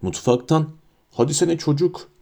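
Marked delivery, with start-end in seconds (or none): 1.1-2.1 higher-pitched, understated yell; mild shout. A narrator's reading. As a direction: call out impatiently without real volume. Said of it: "diye bağırdı".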